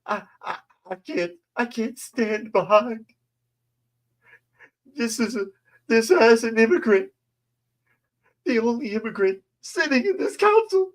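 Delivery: very high, quivering parody of tearful speech; mocking a weepy councilwoman in a very high voice; mock crying. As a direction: ham up a weepy plea. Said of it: "Giraldo said".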